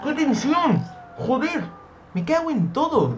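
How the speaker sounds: high, loud swearing cry; tense outburst. Real, not acted. Player cursing the tension of the game, high strained yelling. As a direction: let the tension burst out in a shriek.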